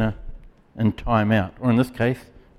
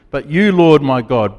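ordinary delivery

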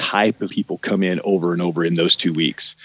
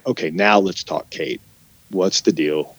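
gentle reassuring boss; 6 semitones lower than usual. Retold or imitated. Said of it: "where you're like".

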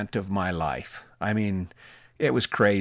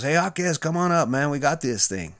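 imitated heavy accent, pitched higher; Lieutenant Martinez; 5 semitones higher than usual. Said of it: "a heavy accent".

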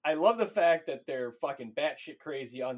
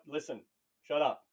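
ordinary talk

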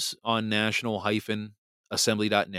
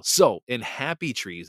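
usual speaking voice

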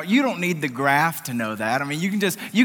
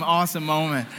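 ordinary delivery

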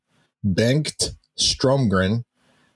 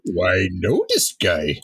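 low monster growl; gruff, excited Cookie Monster; 4 semitones lower than usual. Retold or imitated. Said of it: "Why do I have a Cookie Monster voice".

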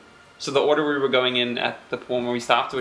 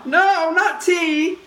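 high, slow squealing protest; drawn-out rabbit no; 13 semitones higher, much slower than usual. Acted. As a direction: stretch the no out in dismay.

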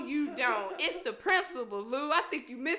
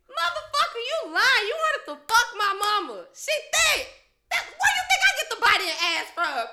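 loud, high-pitched indignant yelling; outraged outburst; 9 semitones higher, 9 dB louder than usual. Acted. Telling him what she wanted to say, outraged shouting.

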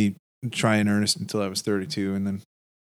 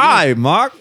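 put-on Australian accent; 6 semitones higher, 4 dB louder than usual. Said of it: "that's pretty good".

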